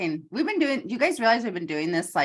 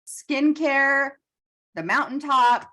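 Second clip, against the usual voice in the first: excited; 10 semitones higher, 4 dB louder than usual. Real, not acted. Excited wrap-up after laughing.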